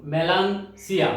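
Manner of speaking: melansia said slowly, syllable by syllable; slow Portuguese word. Shown as a demonstration. Teacher slowly modelling মেলানসিয়া for students. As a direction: spell out মেলানসিয়া slowly for learners. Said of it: "খুব ভালো করে খেয়াল করুন".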